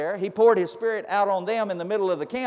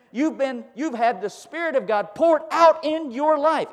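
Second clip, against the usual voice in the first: emphatic preacher's cadence, building higher and louder; full-force preaching; 5 semitones higher, 6 dB louder than usual. Real, not acted. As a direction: drive each phrase home to the congregation.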